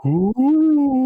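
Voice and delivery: high, loud, eerie ghost moan; ghostly ooh. Acted. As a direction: wail it like a spooky ghost. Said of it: "I just got shivers".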